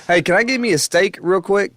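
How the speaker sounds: high, hammed-up American accent; thick American accent. American abroad asking for steak, thick American accent. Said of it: "with that thick American".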